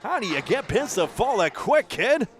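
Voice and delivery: high, excited, nearly shouting; thrilled play-by-play outburst. Real, not acted. Commentator thrilled by a great shot.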